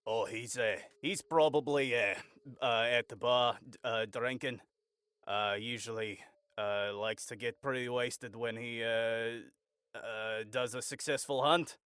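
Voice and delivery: low, quiet local, lightly voiced; grumbling passerby. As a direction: mumble casual gossip with hesitations. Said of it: "grumbling words about".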